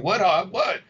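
mock innocent D C. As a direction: play dumb, pitch up. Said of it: "He's looking at me like".

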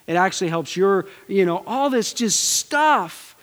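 ordinary talk

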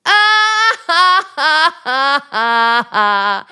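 loud high laugh; laugh of hysteria. Acted. Pupil trying the hysterical laugh for her coach. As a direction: let out a wild, abandoned laugh. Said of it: "You sound like you just heard a joke".

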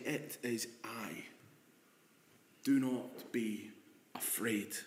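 very soft and slow, sinking to a whisper on afraid; gently calm them, almost breathing it; hushed reassurance. Acted. Jesus calming terrified disciples, soft slow hush.

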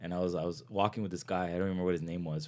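ordinary delivery

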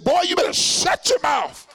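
high, loud mock maternal yell; shouting mother. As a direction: explode like a mom cutting off back talk. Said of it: "My mother would say".

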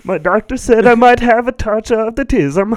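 loud squeaky joke voice; high goofy voice. Pretend. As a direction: squeal it like a cartoon kid. High put-on voice.

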